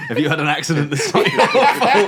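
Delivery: loud, high cheesy ad voice; injury lawyer ad. Pretend. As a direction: pitch it like a TV injury claims advert. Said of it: "he asks you if you want any legal advice".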